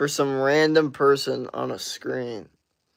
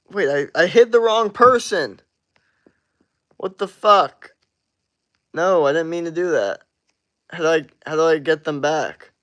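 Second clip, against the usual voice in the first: loud, higher, panicked apology; flustered alarm; 3 semitones higher, 7 dB louder than usual. Real, not acted. Streamer realizing he kicked the wrong viewer, loud flustered panic. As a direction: blurt it out, alarmed at the mistake.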